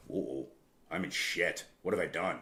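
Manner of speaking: hushed, sinking inner panic; mock dread. Thirty-six-year-old son caught out by dad, hushed mock dread. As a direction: mutter it like you've just been caught.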